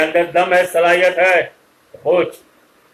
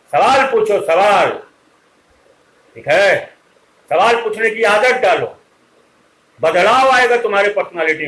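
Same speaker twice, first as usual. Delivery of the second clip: louder, higher-pitched teacher's urging; emphatic exhortation; 4 semitones higher, 3 dB louder than usual. Real, not acted. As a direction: drive each command home at raised volume. Teacher urging students to ask questions. (ज़ोर देकर, ऊँची आवाज़ में नसीहत)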